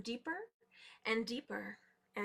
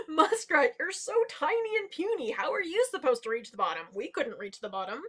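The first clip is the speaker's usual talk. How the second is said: mocking large animals; 2 semitones higher, 5 dB louder than usual. A narrator's reading. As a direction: scoff loudly at someone puny. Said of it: "started erupting in laughter".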